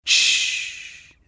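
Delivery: soft whispered shh; whispered shushing. A narrator's reading. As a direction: hush the boy with a quiet shh. Detective puts finger to lips.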